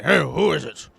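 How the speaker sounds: lightly voiced gruff smith; a hint of a gruff guard calling; gruff male voice. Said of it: "you hear a gruff male voice say".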